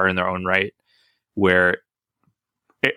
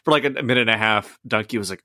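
usual speaking voice

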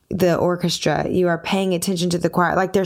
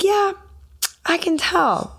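much higher, mock-knowing "yeah"; imitating sound guy; 11 semitones higher than usual. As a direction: play the sound guy who saw it coming. Imitating sound guy's reply.

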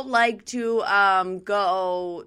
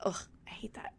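whispered aside; 17 dB quieter than usual. Real, not acted. Whispered remark.

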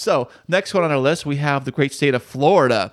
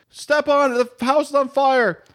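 urgent plea; 9 semitones higher than usual. Acted. Acted urgent passenger.

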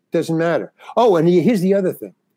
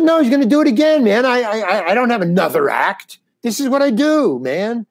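upset protest; 6 semitones higher than usual. Retold or imitated. To his manager after the bottle attack, an upset high protest. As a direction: blurt it out, wound up and pleading.

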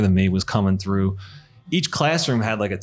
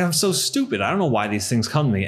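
usual speaking voice

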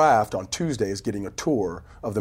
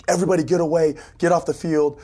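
insistent shout; 6 semitones higher than usual. Retold or imitated. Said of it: "he was insistent".

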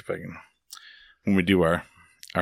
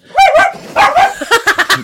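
high, loud laughing burst; burst of laughter; 7 dB louder than usual. Real, not acted.

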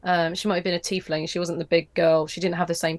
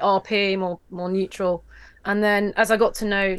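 usual speaking voice

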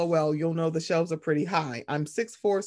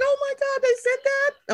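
excited mom voice; 5 dB louder than usual. Retold or imitated. Mother thrilled by fan messages.

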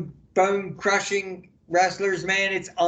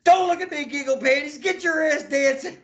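hissing at him off mic, cracking up; panicked boss whisper-shout; 8 semitones higher than usual. Retold or imitated. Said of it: "covered up the mic, goes".

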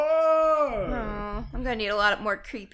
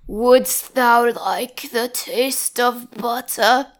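slow, louder archaic tempter; intro character's line; 6 dB louder, much slower than usual. Acted. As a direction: draw out the invitation slowly and loudly. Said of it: "you're just gonna do the".

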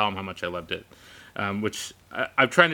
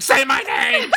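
high, loud comic yell; retold shout, pitched way up; shouted demand; 11 semitones higher, 6 dB louder, slower than usual. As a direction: yell it as an impatient order. Repeats demand louder to sister.

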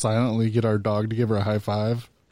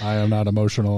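low, robotic denial; deadpan monotone; 5 semitones lower, 3 dB louder than usual. Pretend. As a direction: deny it in a mock emotionless drone. Answers remark on monotone voices.

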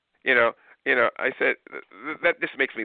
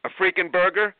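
higher, louder mock outrage; mock upset; 10 semitones higher, 4 dB louder than usual. Customer pretending outrage at a burger. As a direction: pretend to be shocked and annoyed. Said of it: "get upset and go".

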